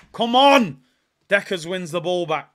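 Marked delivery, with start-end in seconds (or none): 0.1-0.8 loud, higher single burst; urging shout. Real, not acted. Fan shouting encouragement at the screen. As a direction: push the team on.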